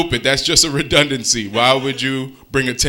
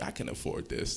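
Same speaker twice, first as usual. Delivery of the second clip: whispered lie; 22 dB quieter than usual. Retold or imitated. Lying to yourself quietly.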